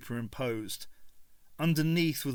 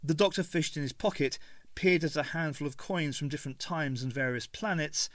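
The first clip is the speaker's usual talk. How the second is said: ordinary delivery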